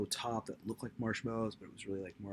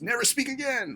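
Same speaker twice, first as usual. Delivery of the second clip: mock command; 8 dB louder than usual.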